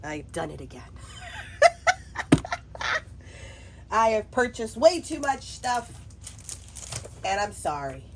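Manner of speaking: ordinary talk, nothing marked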